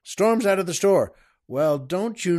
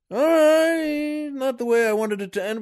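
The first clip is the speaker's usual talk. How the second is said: long, high, louder 'all right'; pitched-up, strained concession; grudging agreement; 9 semitones higher, 4 dB louder than usual. Acted. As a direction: stretch it out in exasperated surrender. Lawyer grudgingly gives in.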